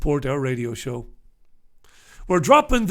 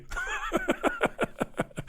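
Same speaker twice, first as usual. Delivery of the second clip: high, wheezy run of laughter; helpless laugh at your own joke; 10 dB quieter than usual. Real, not acted. Laughing at his own headline joke.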